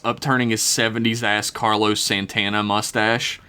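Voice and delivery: ordinary delivery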